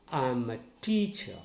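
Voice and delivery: "teacher" stressed on TEA, cha falling away; first-syllable stress. Shown as a demonstration. Teacher models a sentence, "teacher" stressed on TEA. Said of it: "applying the stress on first syllable".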